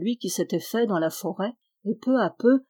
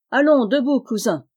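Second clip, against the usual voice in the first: louder, higher wake-up call; 4 semitones higher, 6 dB louder than usual. Acted. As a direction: shout to rouse them. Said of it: "cria-t-il".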